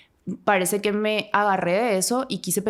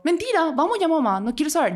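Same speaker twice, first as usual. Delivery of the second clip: agitated denial; 10 semitones higher than usual. Retold or imitated. Daughter refusing the doctor's diagnosis to her mom, higher agitated denial. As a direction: push back in a rush, half panicked.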